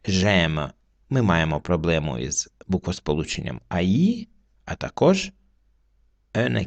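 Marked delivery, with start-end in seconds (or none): 0.0-0.7 clean French J'aime, one syllable; teacher models J'aime for students; French word spoken. Shown as a demonstration. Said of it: "так воно промовляється".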